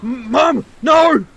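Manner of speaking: high, loud panicked shout; desperate cry. Acted. Son screaming to his mother as he is held. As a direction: cry out for her in terror.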